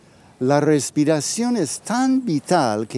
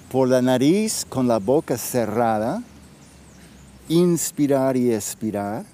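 right in your ear. Acted. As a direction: guide them gently inward, with long pauses. Leads a guided breathing practice.

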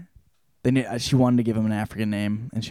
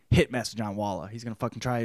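usual speaking voice

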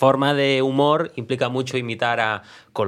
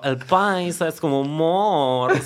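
slow, higher paisa Colombian accent with a laugh; 5 semitones higher, slower than usual. Shown as a demonstration. Friend asks for paisa, he does a slow, higher, laughing paisa Colombian accent. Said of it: "¿El paisa o el Medellín?".

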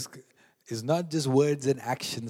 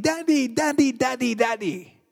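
lightly voiced toddler calling daddy; high happy child's call, lightly done; excited toddler calling; 10 semitones higher, 5 dB louder than usual.

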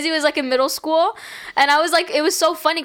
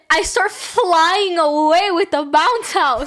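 raised, drawn-out storytelling punchline; amazed climax; 5 semitones higher, 4 dB louder, much slower than usual. Real, not acted. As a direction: deliver the absurd twist with rising excitement. Climax of a wild anecdote.

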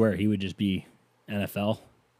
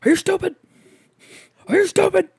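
much higher, loud hammed-up yell; dad yelling; 8 dB louder than usual. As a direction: bellow at the kid like a furious father.

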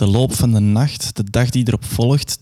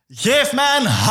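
high cheerleader call; rallying call; 12 semitones higher than usual. Acted. [name] calls the silent crowd, high rallying cry. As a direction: call out to the crowd, much higher. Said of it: "En ik roep".

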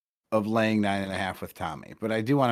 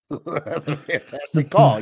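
laughing; 5 semitones higher, 6 dB louder, slower than usual. Real, not acted. Laughing at a call.

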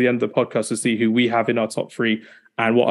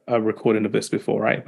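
usual speaking voice

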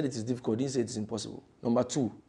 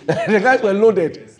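laughing; 6 semitones higher, 11 dB louder, slower than usual. Real, not acted. Laughing restatement.

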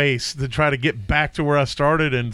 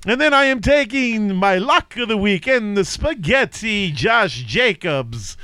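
much higher hammed-up mock Italian voice; Italian accent; 6 semitones higher than usual. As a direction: read the picks like a cartoon Italian chef. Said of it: "Three is Italian".

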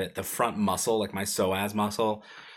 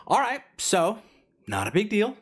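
breezy reassurance; 12 semitones higher, 4 dB louder than usual. Retold or imitated. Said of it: "claps his hand and goes".

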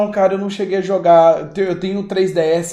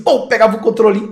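usual speaking voice

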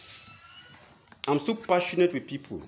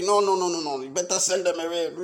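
usual speaking voice

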